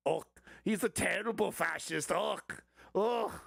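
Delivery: high-pitched, disgusted comic dictator; Adolf Hitler. Acted. Comic imagining an old dictator critiquing Trump, high exasperated mock voice. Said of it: "It's like Adolf Hitler".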